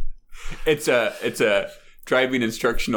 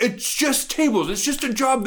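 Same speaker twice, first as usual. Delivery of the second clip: high, insistent; exasperated instructor; 13 semitones higher than usual. Retold or imitated. The instructor answering the class, high and insistent. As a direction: insist it's obvious. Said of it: "He's like".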